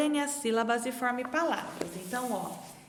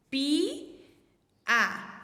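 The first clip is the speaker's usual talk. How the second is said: "Pi-a" split into two clear syllables, "Pi" then "a". Shown as a demonstration. Teacher splitting "Pi-a" into syllables for the class. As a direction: break "Pi-a" into its two sounds for the children. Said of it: "formar esses dois sonzinhos".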